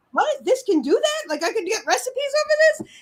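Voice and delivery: high, incredulous questioning; retold disbelief. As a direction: act out your amazed first reaction. Retells own disbelief in kitchen.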